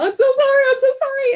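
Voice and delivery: high, loud, pleading "I'm so sorry"; upset kids' apology. Retold or imitated. Scared kids apologizing to their crying mother, high and loud. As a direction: cry it out like a frightened child.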